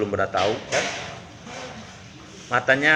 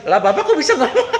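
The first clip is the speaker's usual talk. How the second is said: astonished laughing cry; 13 semitones higher, 4 dB louder than usual. Acted. Hunter stunned deer speaks.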